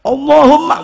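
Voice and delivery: loud, high pleading call; frightened sailors shouting to God; retold frantic crying out. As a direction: wail to God as the boat pitches. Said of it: "Teriak-teriak".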